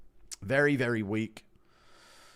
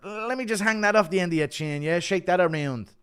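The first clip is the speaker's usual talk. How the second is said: lightly imitated fighter, higher and louder; DC and Conor; 9 semitones higher, 6 dB louder than usual. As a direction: put on a bit of a playful fighter voice. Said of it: "Impression of D C and Conor".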